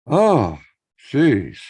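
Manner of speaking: ordinary talk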